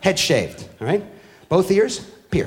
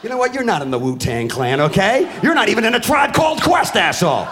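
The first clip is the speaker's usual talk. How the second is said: angry rant; 4 semitones higher than usual. Acted. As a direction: yell it at him like a fed-up dad. Ranting at the kid.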